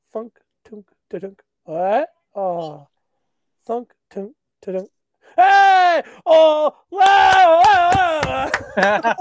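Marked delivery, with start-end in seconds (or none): 5.4-9.1 put-on loud, high drunken yell; screaming. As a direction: scream the party chant. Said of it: "they would scream that song".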